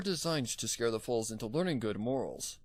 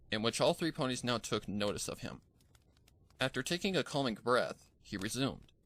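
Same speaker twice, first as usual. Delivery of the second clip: ordinary delivery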